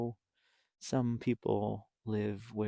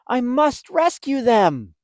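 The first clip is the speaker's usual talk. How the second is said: higher, louder determined cry; urgent resolve; 11 semitones higher, 10 dB louder than usual. Acted. Stellaluna deciding to rescue her friends. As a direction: rush to the rescue.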